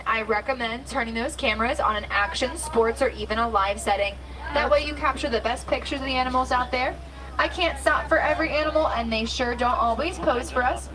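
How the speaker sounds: upbeat tour guide announcement; safari guide spiel. Acted. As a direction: welcome riders like a safari guide.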